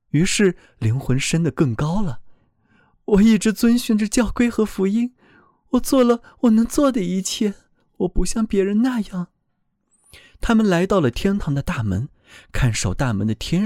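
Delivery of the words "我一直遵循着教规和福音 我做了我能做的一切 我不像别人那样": higher, earnest voice of a frightened soul; pleading soul voice. A narrator's reading. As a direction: speak up as a soul defending itself. Soul boasts of piety.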